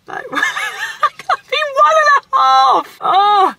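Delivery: very high, loud, drawn-out wail with laughter; exasperated wail. Real, not acted. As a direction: wail helplessly, half laughing. Upset venting after a dismissive appointment.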